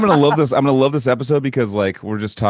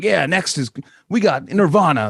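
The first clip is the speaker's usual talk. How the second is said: upbeat higher DJ announcer voice; radio host voice; 8 semitones higher than usual. Retold or imitated. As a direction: pitch it up like a radio DJ. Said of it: "you sound like a radio show".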